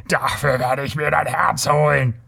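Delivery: theatrical Kothian threat in a put-on voice; furious bawl. A narrator's reading. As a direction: threaten him in blustering rage. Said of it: "gröhlte er". (grölende, wütende Drohung, gespielt)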